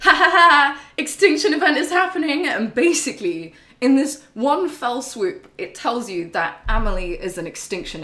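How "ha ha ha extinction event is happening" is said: high, loud gloating villain cackle and boast; hammed-up villain. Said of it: "and Higgs is like".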